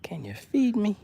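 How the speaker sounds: playful high, soft fish voice; pretend hungry fish. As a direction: plead for food as a pretend fish. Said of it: "I think they said".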